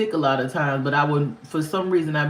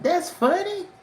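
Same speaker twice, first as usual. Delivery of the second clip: sarcastic; 13 semitones higher, slower than usual. Retold or imitated. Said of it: "And I'm looking like".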